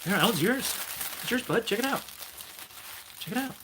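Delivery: very high sing-song offering; pet talk. Acted. Owner giving his dog the new toy, high sing-song.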